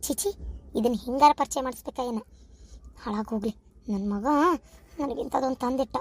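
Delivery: low, soft disapproving grumble; disgusted grumbling aside. Acted. Mother-in-law grumbles to herself about the girl's clothes, disgusted aside.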